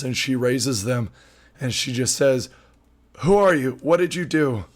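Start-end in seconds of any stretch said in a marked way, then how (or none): 3.2-4.7 a slightly higher, louder, startled demand; wounded warrior woman challenging strangers with raised swords; alarmed challenge. Acted. Wounded woman confronts the party over the bodies, in a mildly alarmed, slightly higher challenge.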